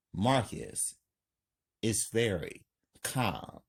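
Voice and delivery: plain reading voice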